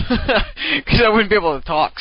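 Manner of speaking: talking through laughter; laughing. Real, not acted. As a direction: laugh while you say it. Laughs at own robot joke.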